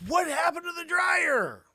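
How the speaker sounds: loud high shocked wife; alarmed shout. Retold or imitated. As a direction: yell it in disbelief. Wife alarmed at missing dryer.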